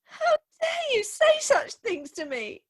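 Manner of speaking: high, tearful indignant cry; indignant outcry. Acted. Bride rebuking her suitor, high indignant cry. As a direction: cry out in wounded outrage.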